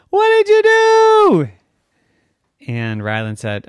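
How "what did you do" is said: very high, loud, slow horrified cry; shocked mom. Acted. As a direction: gasp it out in horror. Mom finds the mess.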